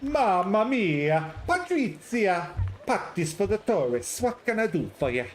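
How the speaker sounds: slow, high, hammed-up Italian accent. Patrizia greeting the visitor at her stall, hammed-up Italian accent. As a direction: stretch it out like a big Italian greeting. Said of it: "Italian accent, please".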